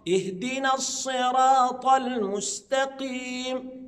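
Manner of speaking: slow recited "اهدنا الصراط المستقيم", emphatic sad and ta; heavy ta. Shown as a demonstration. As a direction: stretch "الصراط المستقيم", heavy in the first word. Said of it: "يبقى منطقة مفخمة".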